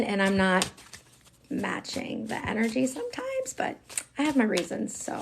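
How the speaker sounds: normal talking voice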